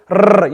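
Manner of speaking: brief trilled rrr; rolled r. Shown as a demonstration. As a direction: let the tongue shiver briefly. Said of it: "You're producing that shiver".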